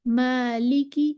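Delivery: "मालिकी" drawn out slowly with a thin, light first vowel; slow, light long alif in "मालिकी"; बारीक अलिफ. Shown as a demonstration. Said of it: "इसको हम बारीक ही पढ़ेंगे". ("मालिकी" का अलिफ बारीक, धीरे खींचकर)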